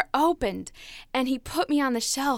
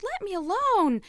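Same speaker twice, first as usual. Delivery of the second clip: higher, pleading cry to be left alone; yelled protest; 9 semitones higher than usual. Acted. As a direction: protest under the master's hands. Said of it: "and I yelled".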